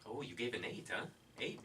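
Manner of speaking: usual speaking voice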